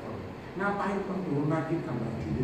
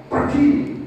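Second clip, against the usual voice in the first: loud quoted command; 8 semitones higher, 8 dB louder than usual. Retold or imitated. Quoting someone saying go.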